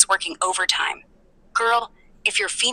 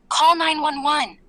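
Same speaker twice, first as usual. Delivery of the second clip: higher-pitched, half-hearted mock yell; 7 semitones higher than usual. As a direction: imitate a yell without much volume. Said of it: "she yells".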